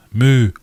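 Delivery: a clear cow moo, loud. Shown as a demonstration. Streamer mooing to build up the word Moor. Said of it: "Put an R on the end".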